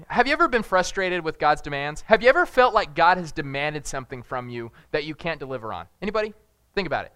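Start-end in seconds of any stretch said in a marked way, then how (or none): none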